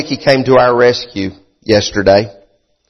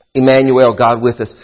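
ordinary delivery

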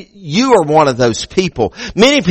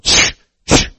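robber shushing. Acted. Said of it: "Old robber's going".

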